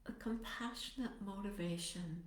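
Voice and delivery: soft, unhurried meditation voice with long pauses; guided meditation. Acted. Teacher settling meditators into posture and compassionate motivation, guided meditation. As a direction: ease them gently into stillness, phrase by phrase.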